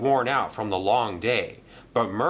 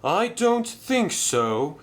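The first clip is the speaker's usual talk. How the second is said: higher, slow sad answer; 11 semitones higher, slower than usual. Acted. Merlin answering Madge, higher, slow and a touch sad. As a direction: let disappointment show. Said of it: "he said sadly".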